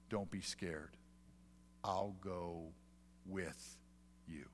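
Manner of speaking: very soft, tender comfort; a mother's soft promise; tender reassurance. Retold or imitated. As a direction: hush a frightened child gently. Mom comforting scared son.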